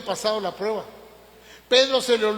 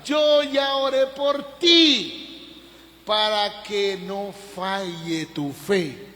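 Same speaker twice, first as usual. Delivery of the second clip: the Lord's tender words; 5 dB louder, much slower than usual. Acted. The Lord comforts Peter before his fall, slow and tender. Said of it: "qué lindo el Señor".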